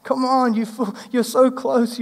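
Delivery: mock-frustrated teacher pleading they almost get it; exasperated Jesus. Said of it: "Jesus' frustration with these followers".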